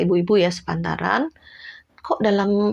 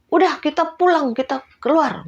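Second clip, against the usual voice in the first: higher, urgent let's-go plea; friend fed up; 8 semitones higher than usual. Retold or imitated. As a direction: urge your friend to leave now. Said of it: "teman saya". (ajakan kesal untuk pergi)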